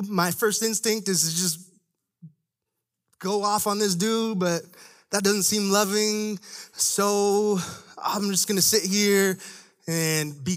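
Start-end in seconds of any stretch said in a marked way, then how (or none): none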